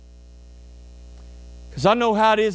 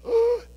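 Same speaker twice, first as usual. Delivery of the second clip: startled gasp. Retold or imitated. Seeing the snake.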